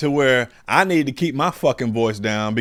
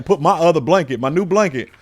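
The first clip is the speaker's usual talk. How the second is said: ordinary talk, nothing marked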